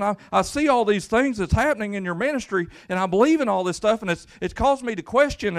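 loud, high, fervent preacher's cry; preaching at full force. Acted. Pastor preaching to his congregation, loud and fervent. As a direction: preach it out to the whole church.

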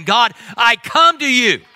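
high, slow, loud shout to God; bold proclamation. Shown as a demonstration. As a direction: declare it boldly before the throne. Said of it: "that's boldly saying".

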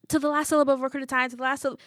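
high, very fast recitation that breaks off; frantic. A narrator's reading. Pupil grasping for the forgotten Macbeth line, frantic. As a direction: race at the line in panic, losing it. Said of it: "I'm frantically trying to remember".

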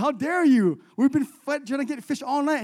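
indignant, high and loud; hammed-up outrage; offended. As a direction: protest like someone deeply insulted. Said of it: "You'd be all offended".

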